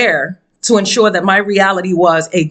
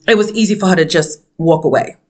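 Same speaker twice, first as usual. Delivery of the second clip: ordinary delivery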